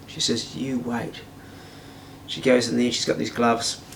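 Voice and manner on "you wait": quiet menace. Retold or imitated. Girlfriend warns the doubting man, quiet menace. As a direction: say it softly as a warning.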